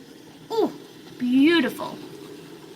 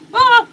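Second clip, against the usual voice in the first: startled cry; 12 semitones higher, 8 dB louder than usual. Real, not acted. Diamond falls off her painting.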